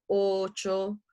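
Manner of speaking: ordinary delivery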